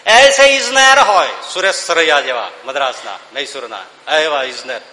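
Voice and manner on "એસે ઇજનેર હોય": declaimed verse. Acted.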